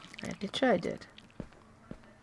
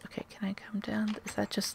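whispering; 7 dB quieter than usual. Real, not acted. Whispering while sneaking underwater.

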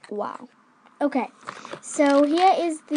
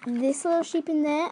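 ordinary delivery

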